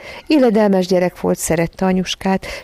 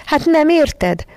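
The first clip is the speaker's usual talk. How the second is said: high, slow, drawn-out angry protest; indignant child's outburst; angry yell; 9 semitones higher, 4 dB louder, much slower than usual. A narrator's reading. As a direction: yell it crossly at someone slow to understand. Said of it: "Ordított rá dühösen John".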